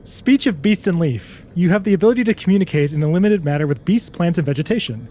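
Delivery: fast rules read-out. Shown as a demonstration. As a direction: rattle off the spell text quickly. Reads spell description.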